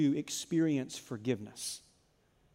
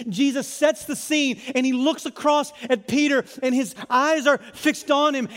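raised, driving preacher voice; full-force preaching; 9 semitones higher, 10 dB louder than usual. Acted. Pastor driving the scene home to the church, raised and intense. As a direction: build it high and insistent from the pulpit.

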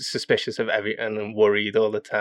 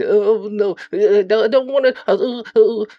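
very high, loud panicky whimper; cowering scared voice; 12 semitones higher, 8 dB louder than usual. Acted. Character voice for the players, high and panicked. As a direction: squeal it like a terrified little coward.

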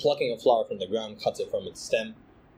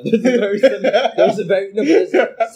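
laughing; 8 semitones higher, 9 dB louder than usual. Real, not acted. Laughing after teasing friend.

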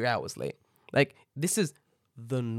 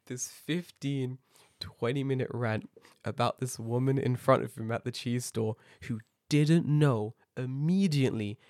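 ordinary delivery

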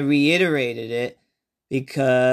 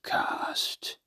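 low eerie whisper, slow and breathy-free hush; creepy whisper; 10 semitones lower, 12 dB quieter, slower than usual. Acted. Mystery host whispering to listeners. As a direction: hiss the intro like a lurking ghoul.

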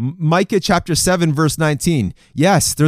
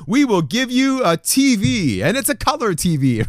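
higher, hyped-up TV pitchman voice; infomercial; 5 semitones higher than usual. Retold or imitated. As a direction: sell it like a late-night ad host. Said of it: "It's like those infomercials".

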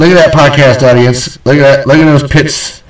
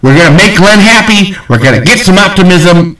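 usual speaking voice

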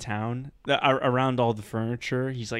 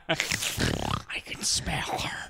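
very slow villain whisper; creepy whisper; 3 dB quieter, much slower than usual. Acted. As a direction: whisper it like a killer on the scent. Deranged butcher sniffing out victim.

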